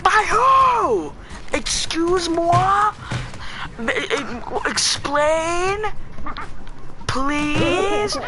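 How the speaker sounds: a mock-indignant shout of shock; outraged disbelief. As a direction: yell it like you can't believe your ears. Retold shocked reply to girlfriend.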